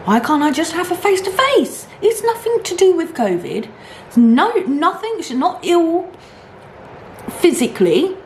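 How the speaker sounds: high, frustrated pleading; exasperated protest. Retold or imitated. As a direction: argue your case, fed up. Retold protest, high pitch.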